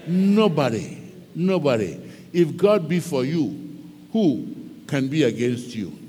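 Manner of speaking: ordinary talk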